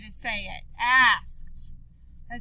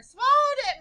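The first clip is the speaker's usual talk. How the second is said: "Swallowed it" cried out much higher; crying out; 11 semitones higher, 5 dB louder than usual. Shown as a demonstration. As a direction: cry it out in alarm. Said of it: "Maria cried".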